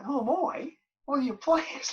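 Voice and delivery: high, astonished retort; incredulous. Retold or imitated. New player answers the manager, high astonished retort. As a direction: throw the question back in disbelief.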